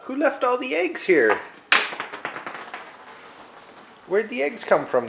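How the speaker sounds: high excited asking. Real, not acted. Excited questions to toddler.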